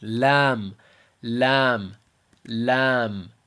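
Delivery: "Lam" drawn out with a light l; light Arabic lam. Shown as a demonstration. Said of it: "this letter is light".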